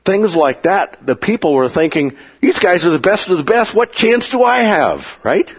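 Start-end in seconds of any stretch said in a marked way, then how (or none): 2.4-5.1 despairing crowd. Retold or imitated.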